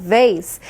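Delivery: "Vase" begun with a buzzing v; V pronunciation. Shown as a demonstration. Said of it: "베이스라고 읽어야 됩니다".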